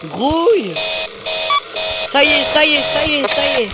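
terrified gamer shouting at the screen; scared horror game streamer. Real, not acted. Panics as Springtrap comes.

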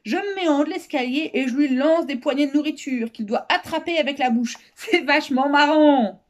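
put-on high, boastful child; gleeful girl. A narrator's reading. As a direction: explain like a delighted kid, building to a loud finish. Girl explains her game with glee.

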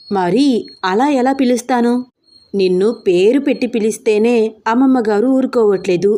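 usual speaking voice

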